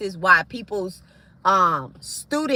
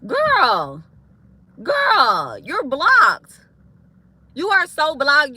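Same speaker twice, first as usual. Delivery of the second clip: taunting yell; 5 semitones higher, 5 dB louder, much slower than usual. Real, not acted. To the troll who keeps making pages: loud high taunt.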